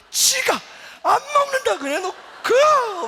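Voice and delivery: the hurt husband crying alone in his study. Retold or imitated. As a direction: wail it through tears. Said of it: "막 울기 시작했어요".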